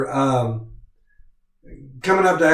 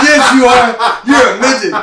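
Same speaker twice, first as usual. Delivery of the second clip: teasing shout; 7 semitones higher, 6 dB louder than usual. Retold or imitated. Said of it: "And I said".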